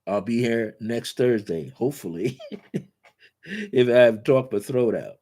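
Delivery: ordinary delivery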